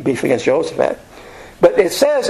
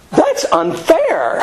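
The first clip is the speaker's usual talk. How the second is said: much higher, slow, put-on whiny voice; mock British whine; 11 semitones higher, much slower than usual. Teacher mocking the British generals, in a high whiny put-on voice. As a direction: drawl it out like a prissy redcoat. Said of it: "which was like".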